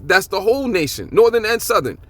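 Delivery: ordinary talk, nothing marked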